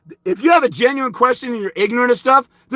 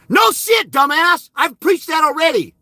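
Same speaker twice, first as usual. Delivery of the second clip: much higher furious yell; angry shout; 6 semitones higher than usual. Real, not acted. Shouting down the commenter's advice, high furious yell. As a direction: throw the insult right in his face.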